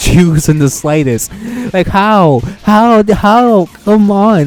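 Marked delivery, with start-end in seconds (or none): 1.7-4.5 exasperated loud how. Real, not acted. Ranting at apology video.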